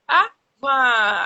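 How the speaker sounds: loud child's word, imitated. Mother imitating her son loudly saying water. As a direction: call it like a little boy asking.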